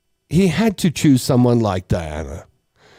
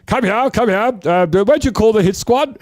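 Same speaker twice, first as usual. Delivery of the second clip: high put-on voice of a royal boss; imitating a royal giving orders, high-pitched; Charles summoning staff; 7 semitones higher than usual. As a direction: summon an underling in a raised, acted voice. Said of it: "He goes".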